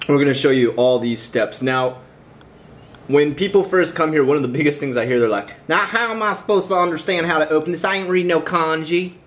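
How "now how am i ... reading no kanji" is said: put-on Southern accent. Newcomer confused by Japanese packaging, in a Southern accent. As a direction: poke fun at newcomers in a thick Southern accent. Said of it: "so I can borrow that accent".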